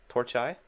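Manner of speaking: "Torch eye" for torches, ending like eye; mock Latin plural. Shown as a demonstration. Gamer puzzling over the plural of torches, "Torch eye" with an eye ending. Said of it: "I wonder if it's torch eye".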